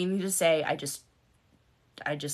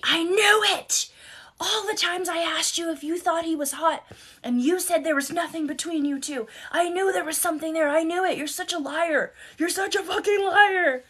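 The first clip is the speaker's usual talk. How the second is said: high, whiny mocking husband voice, yelling; squeaky caricature of an angry husband; mock outraged husband; 10 semitones higher than usual. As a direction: mimic him shrieking accusations.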